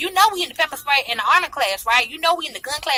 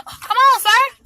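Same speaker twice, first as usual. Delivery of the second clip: teasing; 12 semitones higher, 6 dB louder, much slower than usual. Acted. Teasing the coworker aloud.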